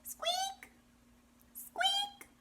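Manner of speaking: very high-pitched little squeak; mouse squeak. Shown as a demonstration. Teacher showing children the mouse sound, tiny high squeaks. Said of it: "and says".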